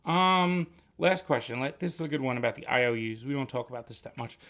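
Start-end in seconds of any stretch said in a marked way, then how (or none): none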